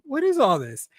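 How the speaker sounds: fed up with the booking; annoyed. Retold or imitated. Wrestling fan retelling his match reaction, annoyed. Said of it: "oh, I was so annoyed".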